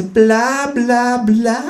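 high, mocking bla bla; mock the speaker in a high voice; bored kangaroo. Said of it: "sagt das Känguru im Fernseher".

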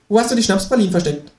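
high, fast teasing kangaroo; quick high tease. Acted. Kangaroo teases narrator about wordplay, high fast.

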